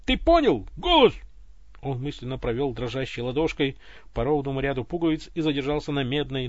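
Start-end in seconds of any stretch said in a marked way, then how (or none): 0.0-1.1 loud, high shout. Acted. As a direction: announce yourself at full voice. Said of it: "заорал Иван". (Иван кричит голосу своё имя)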